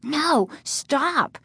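high-pitched frightened objection; high, urgent plea; alarmed cry. A narrator's reading. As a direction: cry out to stop someone. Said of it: "Casey cried".

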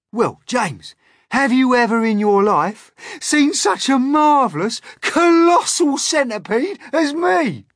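very high, loud showing off; boastful Centipede. A narrator's reading. Centipede shows off to James, boastful. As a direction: blare out your own magnificence.

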